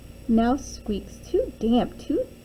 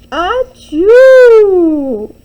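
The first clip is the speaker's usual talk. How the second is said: loud, high, drawn-out "achoo"; huge mock sneeze; 8 semitones higher, 17 dB louder than usual. A narrator's reading. Bear sneezing from a pepper fleck, explosive mock sneeze. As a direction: let a great storybook sneeze explode. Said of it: "He blows and he sneezes".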